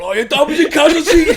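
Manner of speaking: high, loud holler through laughter; hammed-up schoolkid yelling out news; mock excited shout. Schoolmates the day after his dad's TV episode, shouting.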